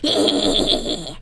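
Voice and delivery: breathy high goblin cackle; goblin laugh. Shown as a demonstration. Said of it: "give me, like, your best goblin laugh".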